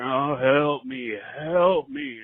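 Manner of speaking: slow drawn-out moan of a ghost, lightly imitated; mock ghostly moan. Caller retelling a ghost story mimics the slow moaning voice. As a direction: moan it out slowly, rising at the end. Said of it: "this moaning voice saying".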